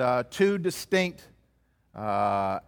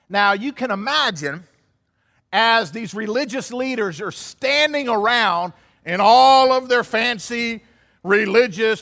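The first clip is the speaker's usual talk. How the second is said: preaching at full force; 4 semitones higher, 5 dB louder than usual. Acted. Preacher to congregation about the scornful leaders, fervent preaching. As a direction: paint the proud leaders for the congregation.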